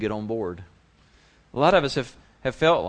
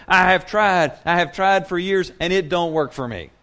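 frustrated doubter; 7 semitones higher, 8 dB louder than usual. Retold or imitated. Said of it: "you're shaking your head going".

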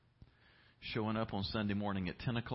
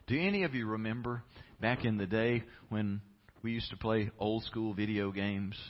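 ordinary talk

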